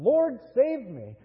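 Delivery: a loud plea for rescue; calling out. Shown as a demonstration. Preacher showing the flock how to cry out for help. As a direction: cry out loud to the Lord, pleading. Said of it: "Call out to him".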